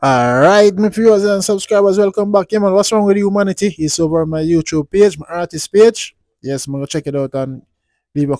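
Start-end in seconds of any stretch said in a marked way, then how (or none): none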